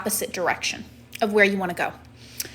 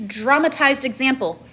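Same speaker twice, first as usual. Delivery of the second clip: ordinary delivery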